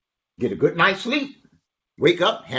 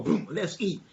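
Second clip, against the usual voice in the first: plain reading voice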